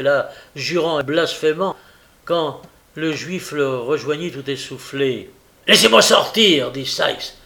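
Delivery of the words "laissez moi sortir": loud, higher furious demand; angry order. A narrator's reading. Sykes at the locked door, furious. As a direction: shout to be let out.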